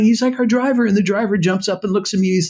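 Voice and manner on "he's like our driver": high retold yell, pleading. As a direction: yell it to defend the driver. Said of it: "And I'm yelling".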